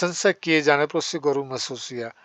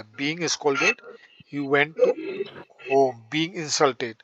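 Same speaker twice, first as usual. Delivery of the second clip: slow dictation; much slower than usual. Shown as a demonstration. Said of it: "teacher dictates sentence".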